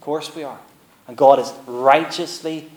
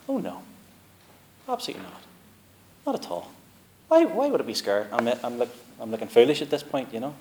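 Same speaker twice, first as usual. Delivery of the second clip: granny's voice; faster than usual. Retold or imitated. Granny voiced in retold hospital chat.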